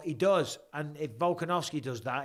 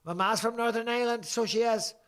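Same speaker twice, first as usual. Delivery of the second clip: a thick Northern Irish lilt, pitched up; broad Northern Irish accent, higher and louder; 8 semitones higher, 4 dB louder than usual. Retold or imitated. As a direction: slip into his mum's Northern Irish accent. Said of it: "my accent on Northern Ireland".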